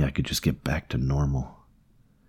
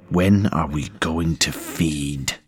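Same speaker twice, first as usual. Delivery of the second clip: slow, drawn-out parasite voice; much slower than usual. Acted. Parasite interrupting its host's thoughts, slow and demanding.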